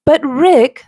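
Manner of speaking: ordinary delivery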